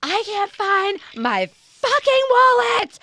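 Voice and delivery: slow, high, loud frantic wail; panicked yell. Retold or imitated. Frantic shopper losing it over missing wallet, high panicked wail. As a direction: wail it like you've lost everything. Retold panic in grocery store.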